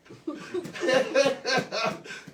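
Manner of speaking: high-pitched giggling burst; amused, high run of giggles; laughing. Real, not acted. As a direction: laugh helplessly at your own slip. Laughing at own Salami slip.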